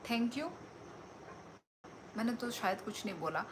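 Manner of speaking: normal talking voice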